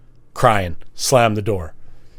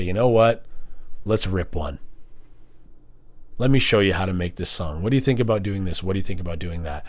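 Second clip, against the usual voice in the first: softer, lower helpful voice; easy and inviting, quieter; helpful mentor; 5 semitones lower, 7 dB quieter, faster than usual. Retold or imitated. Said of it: "I could say".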